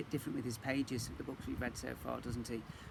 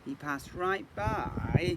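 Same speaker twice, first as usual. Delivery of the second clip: slow refrain; 4 semitones higher, 5 dB louder, much slower than usual. A narrator's reading. Reading to a child, slow drawn-out refrain. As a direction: draw it out slowly.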